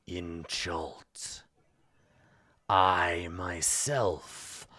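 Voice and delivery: slow, drawn-out villain voice; imperious villain. Acted. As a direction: pronounce it grandly with long pauses. Game master voices the NPC.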